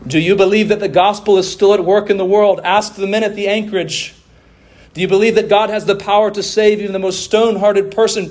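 usual speaking voice

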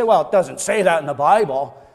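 a lightly voiced objector, a touch dismissive; mock papist objector. Preacher mimics papists denying faith alone, lightly dismissive. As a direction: brush it off like a doubting critic. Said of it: "the papists say".

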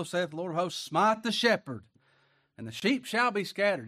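high, loud preacher's cry; fiery preaching. Acted. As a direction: thunder it from the pulpit. Preaching at full force.

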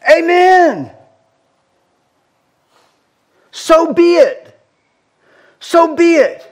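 high, fervent amens, so be it slowed; ringing congregational amen; worshipful amens. Retold or imitated. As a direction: answer with rising praise. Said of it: "And they're going".

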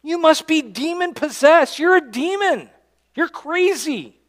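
high, scornful accusation; mocking accusers. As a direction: hurl it like the crowd would. Pastor voices accusers.

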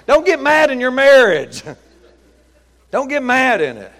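high, loud preacher's warning; hollered admonition. Pretend. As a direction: holler it at the congregation in mock sternness. Preacher hollers his mock-stern admonition.

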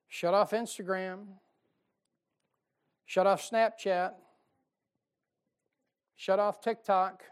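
emphatic pulpit list; building commands. Real, not acted. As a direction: drive each command home harder. Listing apps to shut off.

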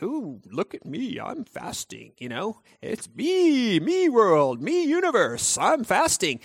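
sing-song self-absorbed mockery; mock them as a preening show-off; mocking narcissist. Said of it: "can you be a little bit more, like, narcissistic".

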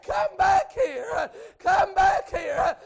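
loud, slow, high calling; crying out. Retold or imitated. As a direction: plead across the field. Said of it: "it sounded like she said".

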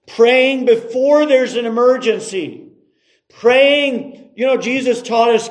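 high, driving sermon delivery; emphatic preaching. Acted. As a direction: stretch and punch the key words. Preaches on praying before need.

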